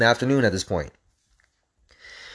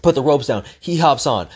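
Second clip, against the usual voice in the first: ordinary delivery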